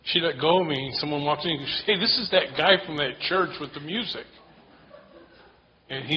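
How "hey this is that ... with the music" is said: higher, louder excited call; excited announcing. Retold or imitated. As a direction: announce him to the room. Man announces him loudly.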